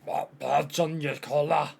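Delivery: slow, wobbly Scottish accent attempt; drawn-out mock-Scottish character voice. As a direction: try out a put-on Scottish voice, slowly. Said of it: "I think I've devolved Scottish".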